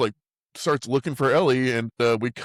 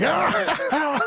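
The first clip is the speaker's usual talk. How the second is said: mock battle scream; 3 dB louder than usual. [name] charging in on dinghy.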